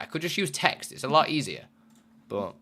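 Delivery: usual speaking voice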